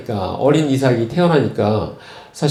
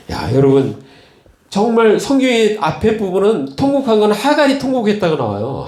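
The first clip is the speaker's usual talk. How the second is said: ordinary talk, nothing marked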